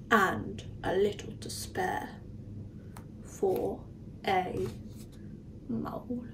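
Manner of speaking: whisper. A narrator's reading. Sleepy story ending.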